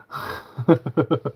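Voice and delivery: rippling, amused laugh; real laugh. Real, not acted. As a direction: chuckle after your own joke. Laughs at own Scouse impression.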